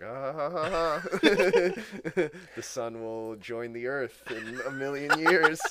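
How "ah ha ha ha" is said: hollow mock laugh, higher; fake laugh. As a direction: act out a grim little laugh. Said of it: "it's like".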